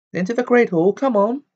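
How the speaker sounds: very high, loud herding call; sharp. A narrator's reading. Professor herding the bedraggled crowd indoors, sharp call. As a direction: chivvy a crowd with stern authority. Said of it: "said Professor McGonagall sharply".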